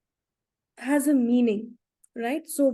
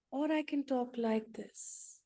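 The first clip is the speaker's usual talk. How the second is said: soft gentle tone; 11 dB quieter than usual. Shown as a demonstration. Speaker demonstrating tone to the group, soft and gentle. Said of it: "The tone".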